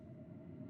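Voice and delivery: quiet breathy self-satisfied laugh; a tiny snicker at his own cleverness; soft smug chuckle. Acted. Pleased with his own excuse.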